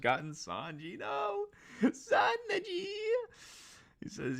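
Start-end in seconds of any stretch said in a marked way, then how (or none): 1.0-2.9 wailing the name; distressed cry. Real, not acted. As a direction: cry out in dismay. Reacting to Sanji captured.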